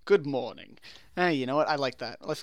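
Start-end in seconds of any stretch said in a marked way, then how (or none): 0.1-0.8 a light stern English accent; clipped-free English gent greeting, lightly done; a hint of a stern English accent; stern Englishman. Shown as a demonstration. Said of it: "I should be a stern Englishman".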